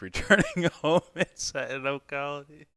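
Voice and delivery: high, laughing read of the line; reading it out while cracking up. Real, not acted. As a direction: giggle through the words. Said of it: "so fucked up and funny".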